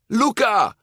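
very high, loud calling shout; mother calling her son across the square. A narrator's reading. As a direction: cry out his name. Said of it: "schreeuwde Daniela".

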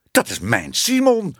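high-pitched indignant outcry; indignant cry. A narrator's reading. Lecturer sees his own cat aimed at, indignant high cry. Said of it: "riep meneer Smit verontwaardigd".

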